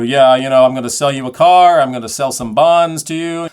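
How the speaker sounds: loud, pressed salesman voice; pushy salesman. Retold or imitated. Contrasting pushy salesman against intimate breathy voice, loud. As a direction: sell it hard like a car dealer. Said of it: "As opposed to".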